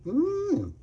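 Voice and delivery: high appreciative mmm; savoring hum. Real, not acted. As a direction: hum with pleasure at the taste. Tasting the cornbread.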